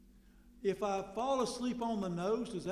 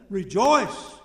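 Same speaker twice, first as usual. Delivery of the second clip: Rejoice called out much louder; loud cry of rejoice; 14 dB louder than usual. Acted. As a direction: shout Rejoice at the congregation. Preaching punch word.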